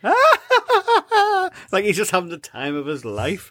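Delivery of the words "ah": very high laughing shriek; giddy squeal. Retold or imitated. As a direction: squeal with joy on the swing. Homer giddy on swing.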